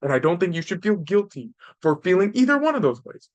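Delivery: normal talking voice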